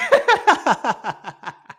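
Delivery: a big burst of laughter; loud laugh. Real, not acted. Host laughing at his own joke, loud laugh. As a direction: crack up loudly.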